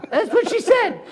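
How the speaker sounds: laughing, voice jumping high. Real, not acted. Lawyer laughing about the zombie report to friends, high. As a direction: crack up while telling it.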